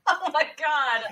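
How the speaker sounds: high-pitched laughing disbelief. Real, not acted. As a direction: react through helpless laughter. Listener cracks up at story.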